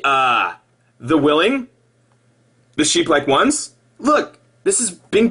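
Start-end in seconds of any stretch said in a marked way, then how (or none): none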